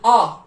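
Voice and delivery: आ said as an open long ah; German A. Shown as a demonstration. Teacher to students, modelling the German ah. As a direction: stretch आ with the mouth wide open. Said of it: "German में इसकी pronunciation जो आती है वो आती है आ".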